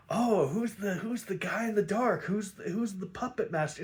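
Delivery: high, sing-song mock suspense; mock mystery build-up. Host mocking the show's build-up of an obvious villain, mock mystery. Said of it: "they build him up like".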